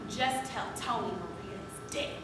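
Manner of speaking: low, hushed and grave; quiet dramatic film quote; grim quoted line. Acted. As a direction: deliver the bad news darkly, very softly. Quoting a bitter film line.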